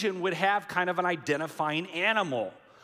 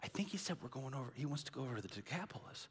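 bewildered muttering; 2 semitones lower, 13 dB quieter, much faster than usual. Retold or imitated. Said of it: "the disciples are like".

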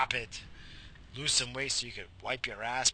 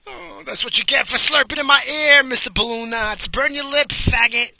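loud, high jeering voice; taunting heckler; 11 semitones higher, 12 dB louder than usual. Acted. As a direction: shout it as a gloating listener. Acts listener gloating at burnt lip.

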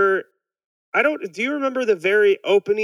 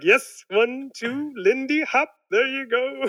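much higher, slow, delighted counting; joyful Burns; 8 semitones higher, slower than usual. Retold or imitated. Burns cheering the dancing panda, in a much higher delighted count. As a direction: count the beat with glee. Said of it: "He's like".

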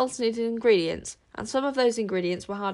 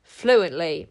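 louder, insistent single word; firm; 2 semitones lower, 7 dB louder than usual. Acted. Tsar insisting he speaks werewolf, firmly. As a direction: plant your foot on it. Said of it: "repeated Tsar firmly".

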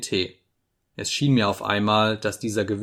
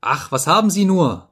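higher, louder cry of alarm; startled cry; 7 semitones higher, 5 dB louder than usual. A narrator's reading. Frightened woman reacting to his outburst, startled cry. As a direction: call out in sudden fright. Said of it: "rief sie erschrocken".

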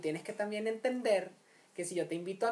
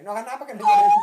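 mock hushing, repeated much louder; 4 semitones higher, 12 dB louder, much faster than usual. Adult hushing a fallen child, repeated louder as a joke. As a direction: push the repeat out louder, half-joking.

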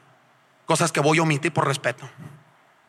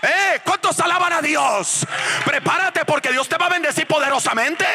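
high, shouted revival preaching; full-force preaching; 11 semitones higher, slower than usual. Real, not acted. Preacher rouses congregation to praise God, full-force preaching. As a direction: drive each promise home, stretching the key words.

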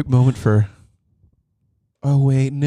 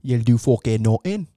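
Irish brogue, hard on the curse; doing a fighter's trash talk in an Irish accent; Conor McGregor; 2 semitones lower than usual. Retold or imitated. Showing his pal his Conor McGregor Irish accent. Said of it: "Conor McGregor imitation".

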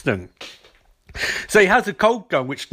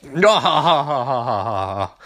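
villain laugh; 4 semitones lower than usual. Retold or imitated. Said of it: "Snart's like".

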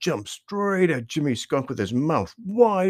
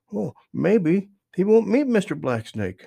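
higher, small voice of wishful thinking; be the little voice in his head, pitched up; little inner voice; 5 semitones higher, 4 dB louder than usual. A narrator's reading. Inner voice reassuring Jimmy about Toad, small and high. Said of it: "said a little voice inside of Jimmy".

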